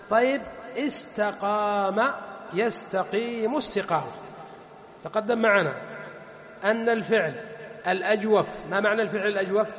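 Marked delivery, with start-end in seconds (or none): none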